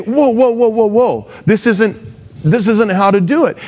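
mock alarm, stopping Jesus mid-sentence; a hint of a shocked disciple; shocked Peter. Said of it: "Or he was shocked".

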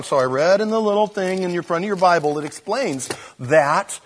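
normal talking voice

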